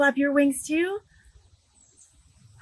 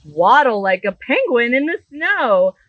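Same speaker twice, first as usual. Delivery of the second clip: bright, lively storybook read-aloud; animated picture-book reading; reading to children; 7 dB louder than usual. Acted. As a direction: read it big for a room of little kids.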